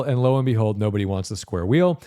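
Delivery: usual speaking voice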